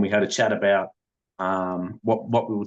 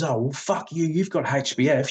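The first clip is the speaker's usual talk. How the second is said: higher-pitched imitation of an upset wife; mildly mock-indignant, pitched up without extra volume; retold upset wife; 5 semitones higher than usual. Wife complaining to her husband about his program, mild retold upset. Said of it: "she got really upset".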